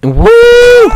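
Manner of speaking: high loud whoop; excited whoop. Real, not acted. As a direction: cheer the final try. Cheering a run.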